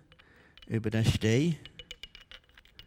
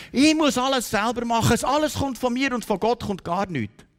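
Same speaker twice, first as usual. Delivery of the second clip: mock overwrought insistence, higher pitched; insistent self-reliance; 8 semitones higher, 4 dB louder than usual. Preacher mimicking self-reliant Christians to the congregation, insistent.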